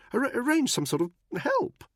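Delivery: high, loud, nervous stammer; stammering Buckingham. A narrator's reading. Buckingham cornered by Danny, stammering high. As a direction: trip over the words in a high upper-class voice.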